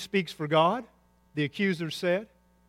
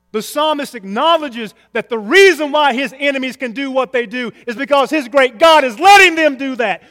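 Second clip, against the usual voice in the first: loud, high preaching declarations; forceful preaching; 7 semitones higher, 10 dB louder than usual. Real, not acted. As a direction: drive each point home from the pulpit.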